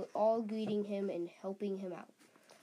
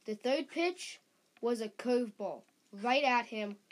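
usual speaking voice

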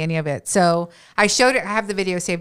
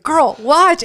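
louder and higher, eager; excited command; 9 semitones higher, 7 dB louder than usual. Retold or imitated. Telling coworker Bianca to watch the video, excited and loud. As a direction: urge your friend to watch now.

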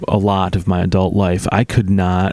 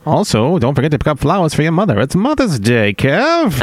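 fast, higher 1940s newsreel announcer; old newsreel; 6 semitones higher than usual. Pretend. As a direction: rattle it off like an old-timey newsreel. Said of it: "like a newsreel from the nineteen forties".

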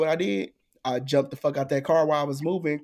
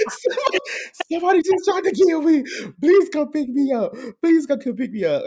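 much higher, fast, loud frantic begging; panicked plea; 12 semitones higher, 4 dB louder than usual. Retold or imitated. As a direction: beg a friend for rescue in panic. Panicked call to his friend.

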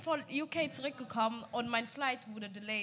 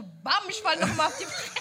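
ordinary talk

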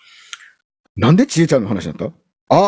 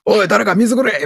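mock rough MC calling for water, higher and slow; hammed-up worn-out singer croaking for water; hoarse MC voice; 9 semitones higher than usual. Hosts joke about a rough-voiced MC, mock hoarse shout. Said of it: "よっぽど声ガラガラやろし".

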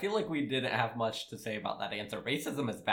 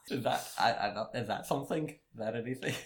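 normal talking voice

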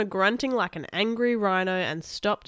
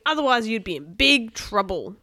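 louder, hammed-up threat; mock scream; 3 semitones higher, 6 dB louder than usual. A narrator's reading. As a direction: warn them loudly, all bluster. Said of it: "screamed Kenny".

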